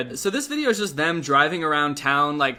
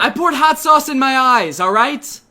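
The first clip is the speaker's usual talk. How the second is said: higher, louder annoyed snap with a laugh; defensive snap; 7 semitones higher, 8 dB louder, slower than usual. Retold or imitated. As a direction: snap back, embarrassed and irritated. Friend asked about eye patch.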